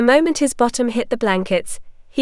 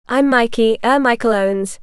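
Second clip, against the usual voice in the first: plain reading voice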